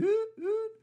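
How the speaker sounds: very high alert hoots; mock alarm. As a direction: imitate a warning siren in a small high voice. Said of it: "flag one went up".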